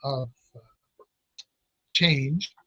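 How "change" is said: normal talking voice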